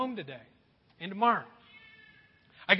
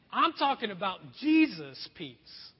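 usual speaking voice

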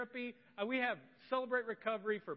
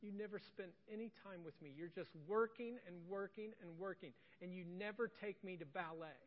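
a hint of a tearful little girl, softer; tearful child; 9 dB quieter than usual. Daughter telling her dad he's never there, near tears. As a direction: quietly plead like a sad six-year-old. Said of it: "she looks really sad, on the verge of tears".

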